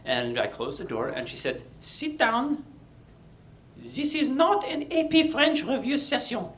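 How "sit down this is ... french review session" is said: much higher stern French teacher; French accent. Retold or imitated. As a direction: announce the intervention in French accent. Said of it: "she spoke like this".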